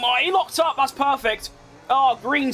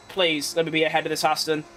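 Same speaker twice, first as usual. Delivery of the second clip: ordinary delivery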